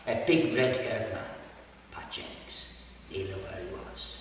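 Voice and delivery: usual speaking voice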